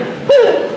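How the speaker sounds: high startled woo; startled whoop. Shown as a demonstration. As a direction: yelp like a scared rider. Demonstrating scared rider.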